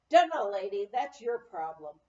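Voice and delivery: loud, dismissive grunt of a reply; rude brush-off. A narrator's reading. Express clerk telling the stranded woman it's her problem, loud and dismissive.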